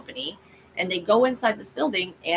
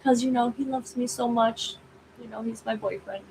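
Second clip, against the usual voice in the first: higher put-on boastful girlfriend voice; Missy; 6 semitones higher than usual. Missy brags to the android about her boyfriend, teasing. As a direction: play a smitten girlfriend, pitched up. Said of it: "And she's like".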